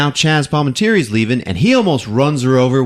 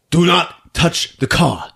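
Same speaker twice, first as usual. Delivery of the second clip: slow, higher, spaced-out words; controlled angry warning; 6 semitones higher, slower than usual. Acted. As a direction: warn her word by word, holding back rage. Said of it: "he's ready to punch her".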